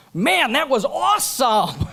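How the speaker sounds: high, loud, slow gleeful buddy voice; buddy's jail remark. Retold or imitated. Speaker voices his sales manager in jail, high and gleeful. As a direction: play the friend grinning about the crime.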